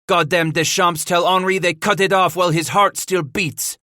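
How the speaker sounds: snarling. A narrator's reading. Paul passes on the threat against his brother, snarling. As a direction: curse them as you pass it on. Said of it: "Paul snarled".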